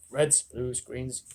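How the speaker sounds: ordinary delivery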